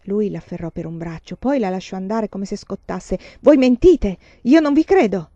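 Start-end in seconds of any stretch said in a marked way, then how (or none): 3.4-5.3 loud, high outburst; angry accusation. A narrator's reading. Client accuses the seer of lying, angry. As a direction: throw the accusation at the nun.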